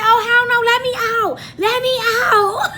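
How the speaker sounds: high, loud cry to be let out; panicked demand. Retold or imitated. Passenger begging the driver to let her out, panicked yelling. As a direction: yell in dramatic alarm. Retold demand to leave moving car.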